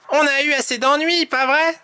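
high-pitched chummy character voice; Tom's voice. A narrator's reading. Tom checks with his friend, high character voice. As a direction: ask it high, seeking agreement. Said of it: "Dit Tom".

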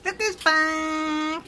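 high, loud, slow delighted call; excited. Real, not acted. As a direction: greet her like a big surprise. Daughter arrives home from France.

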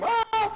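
high piercing scream; power up scream. Real, not acted. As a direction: scream out as you're grabbed. Attacked, then cries for help.

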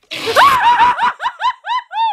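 long, loud, very high shriek; startled shriek. Real, not acted. Viewer jumps at the movie scare, screaming.

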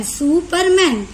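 सुपरमैन drawn out slowly, su-per-man each beat clear; slow syllable demo. Shown as a demonstration. Teacher slowly sounding out सुपरमैन for the class. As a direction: spell सुपरमैन out in slow beats. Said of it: "यहाँ पर three syllables हैं".

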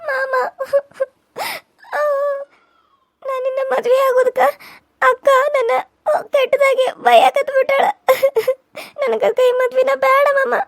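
high, slow tearful complaint; girl weeping to her uncle about her sister; crying. Acted. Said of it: "ಯಾಕ್ ಇಷ್ಟೊಂದ್ ಅಳಾತಿದಿ?".

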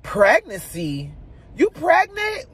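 high, startled mock double take; shocked question. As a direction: imitate him blurting it in disbelief. Husband overhears the pregnancy news.

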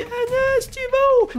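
put-on very high, stretched-out child's cry; mock-dramatic high kid voice; little brother calling out. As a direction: call after him like in an action film. Little brother calling, action-film scene.

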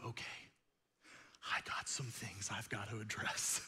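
hushed, unvoiced admission to self; whispered resolve. Preacher voices a reader facing the mirror, whispered. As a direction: whisper it like a private confession. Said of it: "you're supposed to go".

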